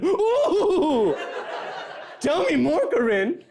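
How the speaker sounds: hammed-up gossip, swooping into a high, loud "ooh"; drawn-out mock-scandalized gossip, rising high; gossiping aunt. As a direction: relish the scandal like a tipsy aunt. Plays gossiping aunt with family secrets.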